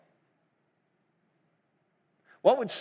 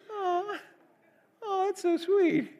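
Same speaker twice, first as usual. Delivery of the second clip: very high, slow, teary gush; gushing teacher; slower than usual. Retold or imitated. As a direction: coo over the toddler like a doting teacher. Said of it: "You probably don't sound like that".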